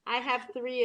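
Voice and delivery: ordinary talk, nothing marked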